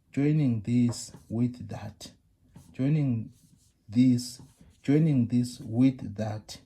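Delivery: joining this with that said slowly and loudly; slow deliberate joining, this stressed; slow emphasis. Shown as a demonstration. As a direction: hammer out each word of joining this with that. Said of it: "the point that I want to emphasize".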